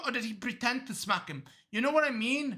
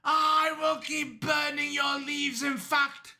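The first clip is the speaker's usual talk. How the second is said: slow, higher-pitched forceful shout; shouted threat; 4 semitones higher, 3 dB louder, slower than usual. Acted. Cop burning the killer's leaves, shouted threat. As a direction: announce the threat in mock fury.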